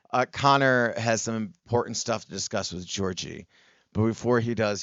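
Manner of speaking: normal talking voice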